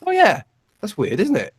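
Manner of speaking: a hint of an old lady catching on; grandma. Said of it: "she started to sort of go".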